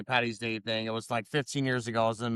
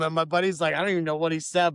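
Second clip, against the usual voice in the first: ordinary talk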